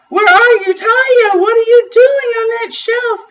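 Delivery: high, loud exclaiming; frantic father. A narrator's reading. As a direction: cry out in high-pitched alarm at your child. Father frantic on finding daughter.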